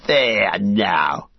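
loud, gloating slave-driver voice; mocking orc overseer, pleased with himself; jeering orc. A narrator's reading. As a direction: jeer at the runners. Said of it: "he laughed".

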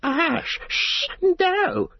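very high, frightened creature shriek; hiss, then shriek in angry fright. A narrator's reading. Gollum seeing the cooking fire, hissing shriek. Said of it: "hissing shriek".

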